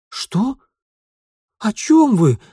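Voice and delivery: put-on high, loud frightened boy. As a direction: blurt it out in fright. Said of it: "Испуганный подросток, охваченный страхом".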